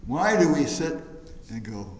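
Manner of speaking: normal talking voice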